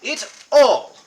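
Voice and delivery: slow, loud, high boast through laughter; hammed-up hunter can't keep a straight face; laughing boast. Reginald boasts of his hunting to the party, laughing.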